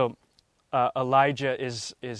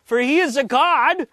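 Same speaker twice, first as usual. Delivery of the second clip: much higher, louder sarcastic taunting; mocking Elijah; 10 semitones higher, 8 dB louder than usual. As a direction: jeer at them, drip with scorn. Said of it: "Elijah mocked them".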